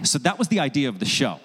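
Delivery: normal talking voice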